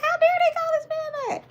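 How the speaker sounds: high squealing disbelief, laughing; high-pitched laughing protest; mock outrage.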